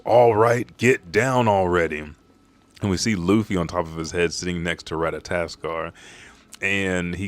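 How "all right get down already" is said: loud, impatient order; imitated yell. Loki ordering riders off his back, yelling. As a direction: punch the start, a half-acted yell. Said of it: "And then he yells".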